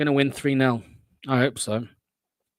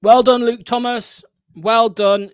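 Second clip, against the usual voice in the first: mock applause, higher and louder; sarcastic praise; 6 semitones higher, 6 dB louder, slower than usual. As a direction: overdo the praise so it stings. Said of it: "by the way, I was being sarcastic".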